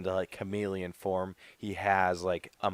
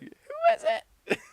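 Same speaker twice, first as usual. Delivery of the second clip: very high, slow shocked cry; shocked squeal; 5 dB louder, slower than usual. Retold or imitated. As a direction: squeal it in disbelief. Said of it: "I'm just like".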